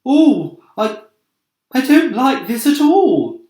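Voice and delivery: a small bug backing away in dread; timid ladybird voice, scared; frightened ladybird. Acted. Ladybird frightened by cloud men.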